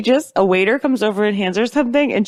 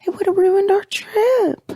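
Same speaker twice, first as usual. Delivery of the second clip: high, drawn-out mock quote; sing-song high imitation; Cameron; 13 semitones higher, slower than usual. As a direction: imitate her way up high, slowly. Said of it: "Cameron was like".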